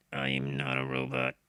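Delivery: very low, loud robot voice; deep droning robot voice. Shown as a demonstration. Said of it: "the only way I can do that voice".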